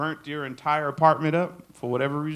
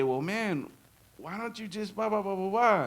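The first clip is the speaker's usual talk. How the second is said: dismissive advice; 7 semitones higher than usual. Retold or imitated. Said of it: "you say".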